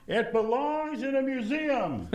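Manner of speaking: high, slow, laughing movie quote; Indiana Jones impression. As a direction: do Indy but laughing through it. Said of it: "like Harrison Ford and Indiana Jones says".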